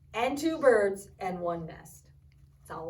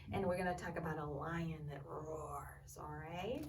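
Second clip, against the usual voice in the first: teasing whisper; 5 semitones lower, 10 dB quieter than usual. Real, not acted. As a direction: whisper it like a secret. Teaser for next lesson.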